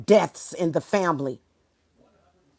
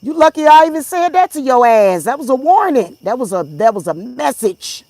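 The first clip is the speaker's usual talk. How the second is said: loud, much higher angry retort; 8 semitones higher, 8 dB louder than usual. Retold or imitated. Vlogger replaying her answer to angry stalkers, loud high anger. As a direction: throw it back at them fiercely. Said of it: "It's like".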